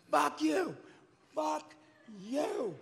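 high-pitched yell held back in volume; mock scream. Singer retelling his rage in the street, mock scream. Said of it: "screaming at the top of my lungs".

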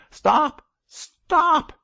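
very high, loud scream to stop; anguished shriek. A narrator's reading. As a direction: shriek it desperately to drown out the prayer. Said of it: "shrieked the unhappy man".

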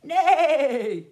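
loud, drawn neigh; whinny it for the children to copy; horse noise. Shown as a demonstration. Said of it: "Can you say that together".

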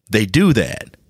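ordinary delivery

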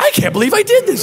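high, fast, sheepish echo; frantic echo. Retold or imitated. Driver echoing the angry owner in a high frantic rush. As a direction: rush it back, mortified.

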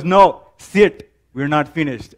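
put-on Indian accent. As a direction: Indian father ordering his son to stay seated. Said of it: "I'm gonna throw in the Indian accent".

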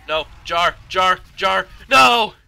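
high, loud, rising panic; escalating frantic yells; frantic shouting. Real, not acted. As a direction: shout desperately, climbing to a scream. Frantic fight in the game.

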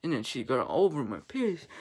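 ordinary talk, nothing marked

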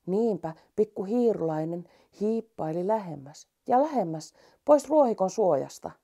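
ordinary delivery, nothing marked

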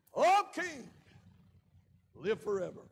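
Daniel answering the king from the den; calling from the den. Retold or imitated. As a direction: call up out of the pit to the king. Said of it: "the voice of Daniel from the den of lions".

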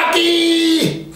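put-on kids shouting. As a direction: pipe up like an eager kid. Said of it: "Y los niños gritan".

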